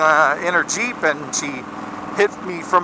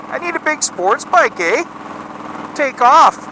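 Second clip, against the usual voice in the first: put-on Canadian accent; 9 semitones higher than usual. Big guy joking about his bike in a mock Canadian accent. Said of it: "eh, when I talk like that".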